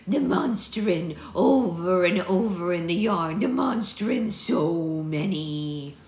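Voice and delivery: loud, slow, drawn-out rustic crone; old seer's prophecy. A narrator's reading. Maud, collapsed, tells of monsters in the yarn, prophesying. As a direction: chant it out like an oracle in a trance.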